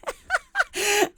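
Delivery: squealing burst of laughter; very high giggling fit; high-pitched laugh. Real, not acted. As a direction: crack up, high and helpless. Cracks up telling the troll story.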